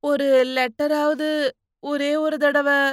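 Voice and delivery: timid pleading; hesitant, slow asking. A narrator's reading. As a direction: beg haltingly for a small favour. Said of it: "மெல்ல மெல்ல கேட்டான்".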